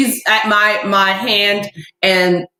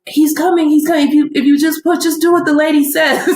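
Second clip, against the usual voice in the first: higher-pitched, lightly frantic coaxing; excited urging; 5 semitones higher than usual. Retold or imitated. Joseph urging the mother to push, excited. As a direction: urge her on, pitch lifted.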